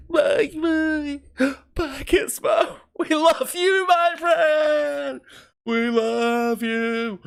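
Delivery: very high, wailing, crying farewell; tearful goodbye. Real, not acted. A reader sobbing a high farewell to the little creature. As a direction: sob out a goodbye to a beloved friend.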